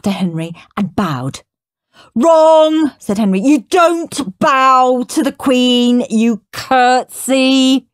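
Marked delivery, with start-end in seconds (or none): 2.1-2.9 high, loud wrong; bossy correction. Acted. Henry bossily correcting Peter's bow. 3.4-7.9 loud, very slow lecture; slow, mock-solemn instruction. Henry solemnly teaching fake royal rules.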